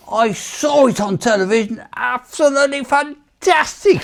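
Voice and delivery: high-pitched, emphatic sergeant impression; staff sergeant. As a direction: imitate a sergeant gushing with praise. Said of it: "this staff sergeant".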